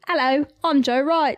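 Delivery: Cockney accent, lightly done. Shown as a demonstration. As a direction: say the name like a Cockney. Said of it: "try Joe Wright's name in a Cockney accent".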